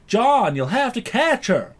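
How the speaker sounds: put-on loud, high, drawn-out American accent; mock a bad Nottingham attempt in an American accent; Kevin Costner. Mocking an actor playing a Nottingham man, exaggerated American accent.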